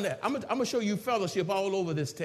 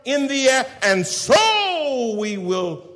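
full-force preaching; 8 semitones higher, 12 dB louder than usual. Acted. Preacher proclaiming the rapture verse to the congregation, full-force preaching.

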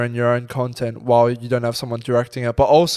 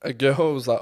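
ordinary talk

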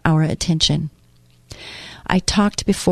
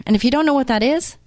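usual speaking voice

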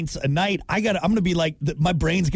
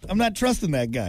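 normal talking voice

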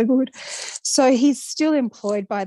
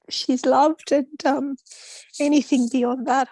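ordinary talk

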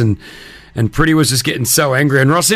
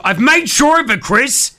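exasperated; 8 semitones higher, slower than usual. Retold or imitated. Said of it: "And I went".

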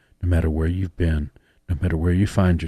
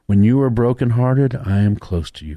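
higher, louder preaching; preaching a love letter; 5 semitones higher, 6 dB louder than usual. Acted. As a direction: proclaim it to the congregation. Preacher reads God's letter loudly.

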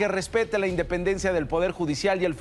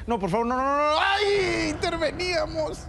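hammed-up aghast onlookers; mock dismay; 10 semitones higher than usual. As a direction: wail it in exaggerated, high-pitched alarm. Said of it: "O sea, todos así de".

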